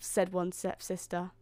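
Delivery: ordinary delivery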